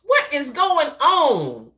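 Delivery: reacting to politicians squabbling, in mock outrage; shocked disbelief. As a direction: stretch it out like you can't believe your eyes. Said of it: "like, I'm saying".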